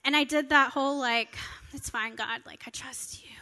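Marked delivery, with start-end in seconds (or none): 1.7-3.3 a soft whispered fake calm; faking it quietly. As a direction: hush it, pretending all is fine. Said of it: "faking it till I make it".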